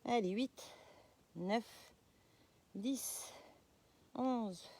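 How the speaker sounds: counting under strain, breath held; holding a plank. Real, not acted. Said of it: "je fais du gainage".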